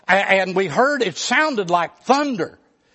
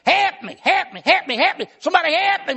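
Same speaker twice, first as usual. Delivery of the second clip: high, frantic cries for help; panicked yelling; 9 semitones higher, faster than usual. Retold or imitated. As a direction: scream for help as if a brother's dying. Same panicked cry retold.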